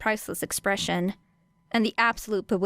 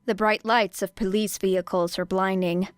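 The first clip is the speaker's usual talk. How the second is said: usual speaking voice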